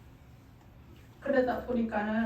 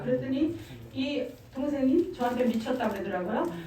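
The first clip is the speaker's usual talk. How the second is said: ordinary delivery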